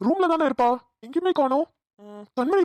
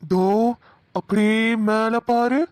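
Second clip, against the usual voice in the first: husky spooky voice; slower than usual. Acted. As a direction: draw her eyes up slowly, eerily. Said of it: "husky voice". (மெதுவான கிசுகிசுப்பான பேய்க் குரல்)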